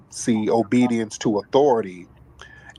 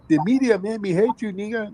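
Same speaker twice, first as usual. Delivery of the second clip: mocking voice; 10 semitones higher than usual. Host mocking immigrants blaming the media, in a high mocking voice. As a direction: parody their excuse in a raised silly voice. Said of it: "They love talking about".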